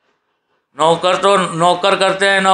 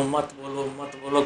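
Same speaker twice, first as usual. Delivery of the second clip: much softer, fading mimicked plea; hushed imitation; 3 semitones lower, 12 dB quieter than usual. Family urging him not to speak, hushed imitation. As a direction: repeat it under the breath, imitating worried relatives.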